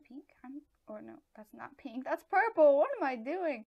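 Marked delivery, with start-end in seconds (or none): 2.1-3.7 loud, high self-reproach; high-pitched, loud confusion; flustered realisation. Real, not acted. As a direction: blurt it out in dismay. Realises she confused the colours.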